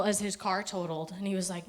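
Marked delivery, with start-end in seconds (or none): none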